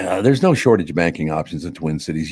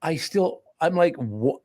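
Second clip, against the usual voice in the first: ordinary talk, nothing marked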